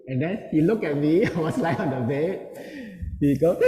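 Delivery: ordinary talk, nothing marked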